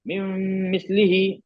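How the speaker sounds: "मिम" held as a long nasal m; ghunna. Shown as a demonstration. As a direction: hum on the m of "मिम" before "मिस्लिही". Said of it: "इसमें गुन्ना".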